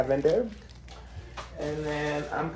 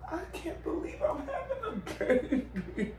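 laughing tearfully; 6 dB quieter than usual. Real, not acted. Emotional over the baby.

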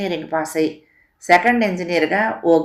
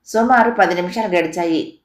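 ordinary delivery

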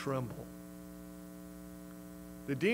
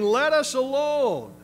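loud, slow, high demon cry; high, drawn-out cry of let us alone; 9 semitones higher, 8 dB louder, slower than usual. Acted. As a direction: wail it like a tormented demon. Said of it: "The demon cried out".

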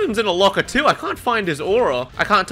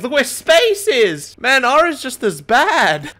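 outraged; 7 semitones higher, 6 dB louder, slower than usual. Real, not acted. Outraged at the key binds.

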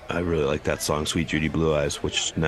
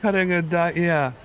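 put-on prepubescent boy voice; 8 semitones higher than usual. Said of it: "Your voice has gotten just more like".

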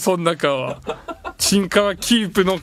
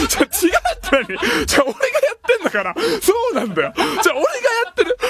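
laughing protest; 12 semitones higher, faster than usual. Real, not acted. Defending his face through laughter.

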